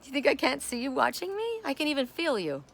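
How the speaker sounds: ordinary talk, nothing marked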